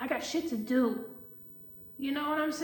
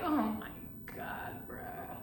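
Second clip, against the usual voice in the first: slow, soft, sinking mock disbelief; weary mimicked exasperation; exasperated groan; 2 semitones lower, 8 dB quieter, slower than usual. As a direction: imitate someone utterly fed up, trailing away.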